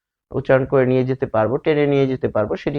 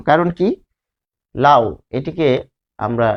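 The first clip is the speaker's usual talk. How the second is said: normal talking voice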